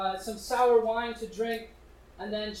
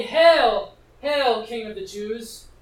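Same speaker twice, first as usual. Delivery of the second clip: loud mock hail, then easing off; mocking acclaim; 8 dB louder, much slower than usual. Soldiers hailing Jesus in his crown of thorns, mocking. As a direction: hail him in mockery, the first word hit hard. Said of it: "they mock him and say".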